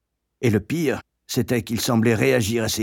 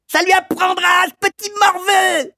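louder, very high spiteful screech; madame Pratchett; 5 dB louder than usual. A narrator's reading. Woman insulting the caned boy, shrieking. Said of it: "hurla madame Pratchett".

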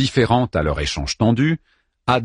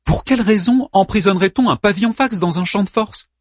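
exasperated woman; 11 semitones higher than usual. Acted. Anna voiced high.

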